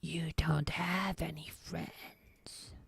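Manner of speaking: slow, creepy whispered taunt like Gollum to Smeagol. Retold or imitated. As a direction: hiss it slowly like a creature talking to itself. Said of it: "like Gollum when he's talking to Smeagol".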